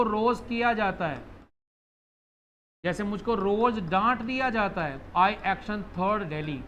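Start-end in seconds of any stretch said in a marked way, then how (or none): 5.1-6.7 slow, word-by-word sentence pattern; teacher spelling out a grammar pattern; slow model sentence. Shown as a demonstration. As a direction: lay out the formula slowly for the class. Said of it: "क्या बोला मैंने?".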